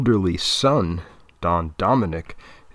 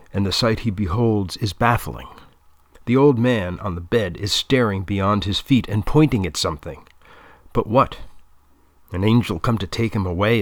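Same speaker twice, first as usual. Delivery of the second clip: ordinary delivery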